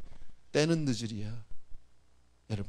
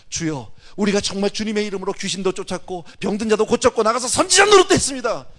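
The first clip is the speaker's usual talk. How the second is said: rising, louder pleading cry to the Lord; 5 semitones higher, 10 dB louder than usual. Acted. Preacher voicing the rejected, crying out in a rising plea. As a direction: cry out like someone begging at a shut door.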